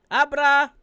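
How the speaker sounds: high, booming name shout; loud call-out. Real, not acted. Calling out the rapper's name. (malakas na sigaw ng pangalan)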